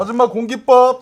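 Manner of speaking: loud, high restaurant call. Pretend. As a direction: shout an order across the room. Calling out for rice. (식당에서 크게 부르듯)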